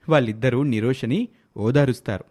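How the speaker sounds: usual speaking voice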